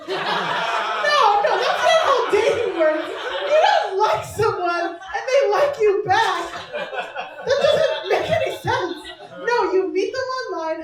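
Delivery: loud, high laughing protest; laughing rant. Pretend. Mocking her friend's easy romance to the crowd, loud, high and laughing. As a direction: rant in mock outrage. Ranting at dating story.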